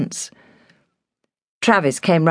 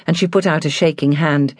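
ordinary delivery, nothing marked

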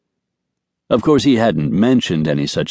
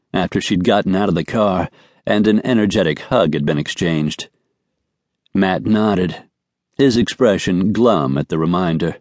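ordinary delivery

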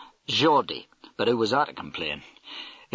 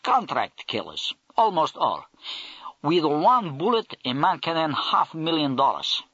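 put-on Russian accent; 5 semitones higher than usual. Russian major explains contract killings.

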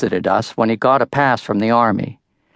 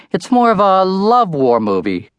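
innocent lie; 9 semitones higher than usual. A narrator's reading. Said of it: "I lied".

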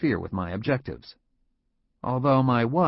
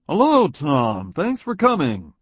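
louder, slow smiling hello; big smile; 5 semitones higher, 7 dB louder than usual. A narrator's reading. Boss welcoming Tom with a big smile. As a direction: greet him with a broad smile in the voice. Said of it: "he said with a big smile".

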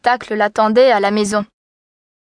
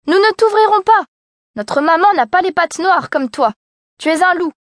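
put-on kids crying out; 7 semitones higher than usual. A narrator's reading. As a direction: shout him off like scared kids. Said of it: "crièrent".

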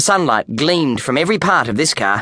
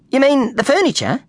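put-on young boy, much higher, unsure; hesitant kid asking a stranger; boy's voice; 11 semitones higher than usual. A narrator's reading.

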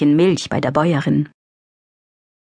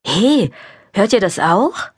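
calling out; 4 semitones higher than usual. A narrator's reading. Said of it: "rief Wilma laut".

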